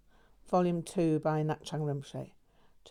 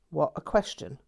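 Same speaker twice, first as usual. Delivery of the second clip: plain reading voice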